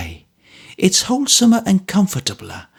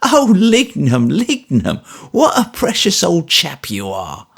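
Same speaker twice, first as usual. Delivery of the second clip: airy laugh; 6 semitones higher, 3 dB louder than usual. A narrator's reading. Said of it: "laughing in her airy way".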